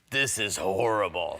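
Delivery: slow, mock-grave cop verdict; imitated cop. Cop at the wrecked axle, slow grave verdict. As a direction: draw it out as the cop. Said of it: "and the cop goes".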